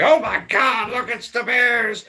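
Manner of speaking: husband imitating his scared wife, high and frantic; panicked wife. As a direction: play the wife shrieking at the bears.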